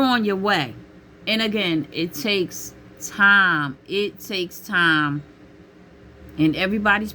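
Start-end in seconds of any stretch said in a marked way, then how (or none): 1.9-3.8 drawn out and slow, stressing time; slow emphasis. Shown as a demonstration. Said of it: "repeated slowly". 3.9-5.2 slow again, pressing It; slow repeat. Shown as a demonstration. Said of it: "repeated slowly".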